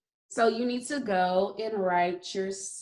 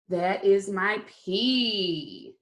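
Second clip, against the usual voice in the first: ordinary talk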